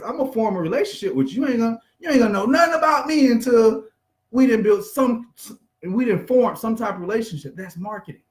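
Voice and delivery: ordinary talk, nothing marked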